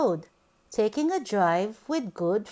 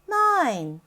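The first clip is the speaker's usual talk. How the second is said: ordinary delivery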